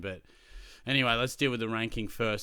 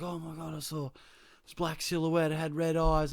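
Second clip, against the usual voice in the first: higher, breathless mock fear; spooked kid; 7 semitones higher, 3 dB louder than usual. Frightened student telling his teacher about red eyes. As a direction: tell it wide-eyed and scared.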